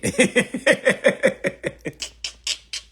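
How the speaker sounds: high, loud mock laughing fit; imitated hysterical laughter. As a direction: act out him dying laughing. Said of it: "He died laughing again".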